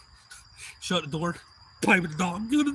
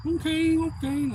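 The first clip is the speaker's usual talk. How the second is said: very high "okay, okay"; imitating someone placating; acted backing off; 12 semitones higher, slower than usual. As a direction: give in with a raised pitch. Acting backing-off reply.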